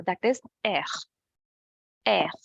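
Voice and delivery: "air" with a guttural French R from the throat. Shown as a demonstration. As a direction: say "air" with the R scraped in the throat, between R and K. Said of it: "more between R and K".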